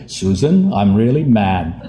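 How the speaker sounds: mild, slow, limp anger with a laugh; barely angry at all, slowed down; mock angry, really meek. Husband telling wife he is mad, meekly mild. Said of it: "This is how I used to get angry".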